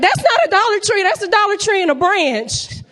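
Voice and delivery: squeaky high outraged quote, loud; high mock outrage. Comic telling the crowd she protested prices, high squeal. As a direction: squeal it in disbelief. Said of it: "I was like".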